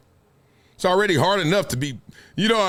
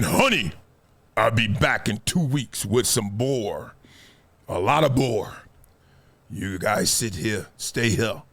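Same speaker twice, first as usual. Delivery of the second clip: low, slow caveman husband; 11 semitones lower than usual. Acted. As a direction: play a primitive hunter in a deep, unhurried voice. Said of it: "no more caveman".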